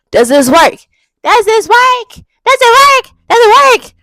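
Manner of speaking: loud, high calling out; loud mic test. Shown as a demonstration. Mic test, getting louder.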